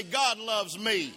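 slow, loud, every word weighted; slow emphatic preaching. Acted. Pastor declaring to the congregation, slow and loud. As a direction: lean on each word like a sermon.

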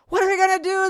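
high, loud mock panic; panicked clients. Clients begging the trainer for help, shrieking. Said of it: "they say".

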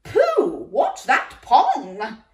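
high, loud, slow shout with a laugh; harp shouting. A narrator's reading. Magic harp shouting at Jack's smell. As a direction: cry out in laughing disgust. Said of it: "the harp shouted".

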